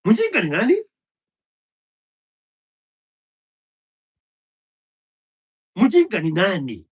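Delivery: loud, high demand repeated; furious rhetorical shout; shouted challenge. Real, not acted. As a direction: throw the question at them. Rhetorical challenge in angry rant.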